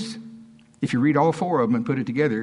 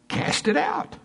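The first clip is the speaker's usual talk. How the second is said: mock command; slower than usual. Answers own question, high mimicked command.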